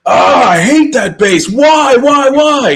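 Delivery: high, loud, outraged cry of disbelief; furious bandmate. Retold or imitated. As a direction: imitate the friend raging over the bass. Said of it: "he was furious".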